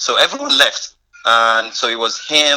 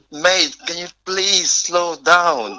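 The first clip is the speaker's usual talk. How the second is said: slow, drawn-out plea, higher; slow pleading; 5 semitones higher, much slower than usual. Retold or imitated. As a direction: ask him to slow down, stretching each word. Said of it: "You were speaking slow".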